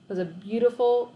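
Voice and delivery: ordinary delivery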